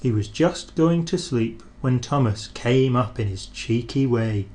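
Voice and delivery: usual speaking voice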